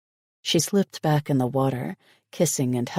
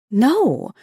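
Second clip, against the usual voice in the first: high, loud eager no; excited; 9 semitones higher, 7 dB louder than usual. A narrator's reading. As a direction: cut in with eager energy. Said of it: "she said excitedly".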